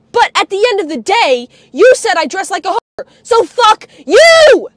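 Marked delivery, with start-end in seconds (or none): 3.2-4.7 loud, drawn-out angry shout; furious shout. Real, not acted. Hitting back at a relative's insult, furious shout. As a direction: stretch the last word into a shout.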